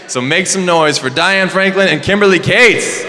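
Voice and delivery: big rising welcome shout; announcer call. Real, not acted. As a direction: whip the crowd up for the guests. Host introduces guests, calling for applause.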